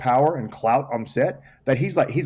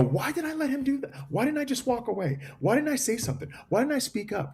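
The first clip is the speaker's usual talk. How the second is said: high, softer string of questions; exasperated self-questioning; 13 semitones higher, 6 dB quieter than usual. Retold or imitated. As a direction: plead with yourself in a lifted voice. Replays his self-questioning.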